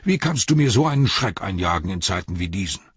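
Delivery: loud, high-pitched telling-off; flustered innkeeper's reproach, easing slightly toward the end; indignant calling. A narrator's reading. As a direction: scold your servant in exasperation. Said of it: "rief er".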